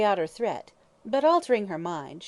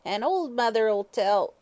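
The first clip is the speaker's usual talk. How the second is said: higher, wheedling hag's croak; croaking fortune teller; 4 semitones higher, 5 dB louder, slower than usual. Acted. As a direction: coax him like a sly old witch. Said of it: "she croaked".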